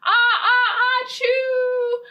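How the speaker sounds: high, loud acted sneeze; big sneeze. Librarian acting the moose's sneeze, high and loud. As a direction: build up then burst into a sneeze.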